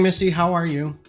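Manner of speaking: ordinary delivery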